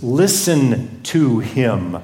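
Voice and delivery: slow, drawn-out solemn command; solemn directive. Acted. God directing the disciples, slow and solemn. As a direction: stretch out each word with weight. God's directive quoted with weight.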